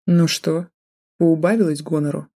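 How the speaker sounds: sneering, snide question. Acted. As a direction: taunt her with a smirk. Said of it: "ехидно поинтересовался он".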